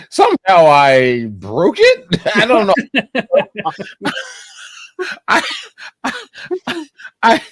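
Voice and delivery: laughing through the words. Real, not acted. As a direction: giggle out the confession.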